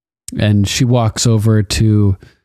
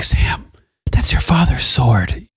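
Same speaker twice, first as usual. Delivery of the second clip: hushed, slow whisper; 2 semitones higher, slower than usual. Retold or imitated. Gilly warning Sam about the sword, slow whisper. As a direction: breathe it out in alarm.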